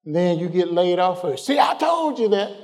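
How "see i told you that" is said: high, smug told-you-so voice; mock told-you-so. Preacher mocking a worker who predicted his own layoff, high smug voice. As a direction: mimic someone gloating that they were right.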